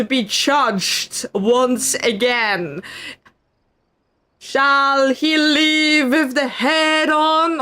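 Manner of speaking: slow, formal hammed-up verdict; mock judge pronouncement. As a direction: pronounce the sentence like a grand judge. Mock court sentencing.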